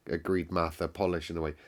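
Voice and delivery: usual speaking voice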